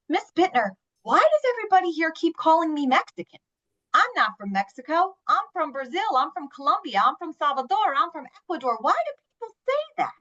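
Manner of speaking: mock exasperation, rising to a high-pitched finish; exasperated students, imitated. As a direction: voice students' frustrated protest, pitch jumping up. Students' complaint voiced in a talk.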